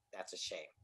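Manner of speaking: low, very soft retold verdict; hushed, understated reproach; quiet disapproval. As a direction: let it drop softly, like a pitying judgement. Retells woman's disapproving reply.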